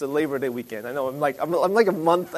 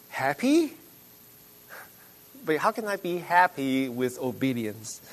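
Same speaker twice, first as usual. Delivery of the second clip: skeptical; 4 dB quieter than usual. Retold or imitated. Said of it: "the typical reaction people usually have".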